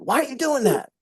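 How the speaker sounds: sharp, confronting why question; accusing why question. Shown as a demonstration. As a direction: fire it like a challenge that puts them on the defensive. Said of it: "All why questions put you in defensive mode".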